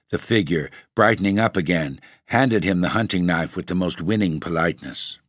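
ordinary delivery

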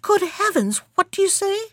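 high startled cry; astonished exclamation. A narrator's reading. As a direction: gasp it out in shock. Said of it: "The king exclaimed".

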